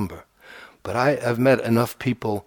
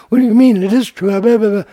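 put-on much higher, louder protesting kid; indignant boy; 10 semitones higher, 8 dB louder than usual. Teacher imitating himself as a boy arguing with his father, high and loud. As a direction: squeal it's true like an offended child. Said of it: "And he'd say".

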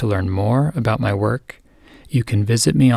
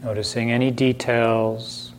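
unhurried meditation voice with long silences between phrases; calm, spacious delivery, leaving room to breathe; guided meditation; 3 semitones higher than usual. Acted. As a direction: gently guide listeners inward, phrase by phrase.

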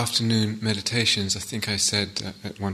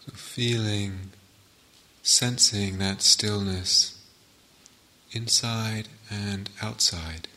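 calm meditation guide with long pauses between phrases; guided meditation; 4 dB quieter than usual. Real, not acted. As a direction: settle listeners into stillness, phrase by unhurried phrase.

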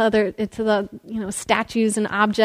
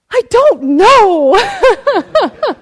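much higher, louder, very slow whine with a laugh; a child's helpless, drawn-out protest; exasperated kid; 11 semitones higher, 9 dB louder, much slower than usual. Retold or imitated. Ricky answering the teacher, higher exasperated whine. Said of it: "he looks at me equally exasperated and says".

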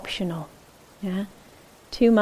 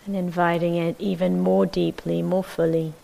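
calm, measured meditation guidance with long silences; unhurried, even voice leaving space between phrases; guided meditation. Acted. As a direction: settle listeners into the body, phrase by phrase.

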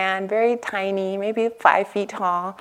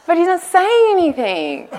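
incredulous; 11 semitones higher, 3 dB louder than usual. Retold or imitated. Retelling own frustrated objection.

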